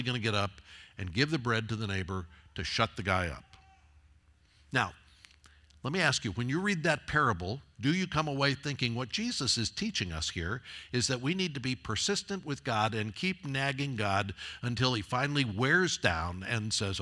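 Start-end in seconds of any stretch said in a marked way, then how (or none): none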